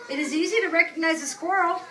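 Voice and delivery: slow, drawn-out storybook reading; unhurried picture-book voice; reading to children. Acted. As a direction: read it slowly to the class. Teacher reads picture book aloud.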